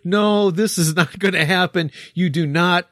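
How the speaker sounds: high, emphatic veto; mock objection. Mock rejection after buzzer.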